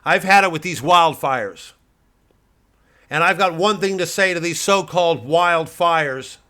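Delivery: loud, high, defiant proclamation; declaration. Retold or imitated. Pundit quoting his speech to wildfires, loud and defiant. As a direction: proclaim it to the nation. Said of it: "I came out yesterday and I said".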